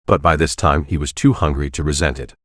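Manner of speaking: usual speaking voice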